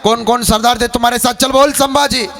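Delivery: high, imperious interrogator's call; Aurangzeb. Acted. Emperor questioning the captive Sambhaji, high commanding demand. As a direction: demand answers from a prisoner, ringing and commanding. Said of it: "Alamgir Aurangzeb बोलता झाला".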